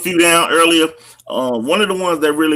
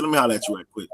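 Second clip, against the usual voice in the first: low, softer word from a dad; dad's private aside; 7 semitones lower, 6 dB quieter, much faster than usual. Retold or imitated. Dad pulling his son aside to talk, low and quiet. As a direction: pull your son aside, low and casual. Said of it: "that's exactly how I talked".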